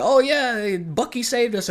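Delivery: high-pitched imitation of relieved cheering; mock cheering. Acts out the Thunderbolts' cheer.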